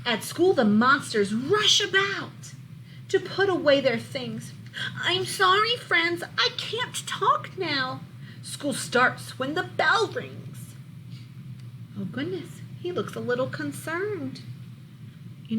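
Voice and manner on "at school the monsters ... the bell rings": lively, louder storybook read-aloud; reading to children. A narrator's reading. Teacher reading a monster book to her class, lively storybook voice. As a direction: bring the picture book alive for the class.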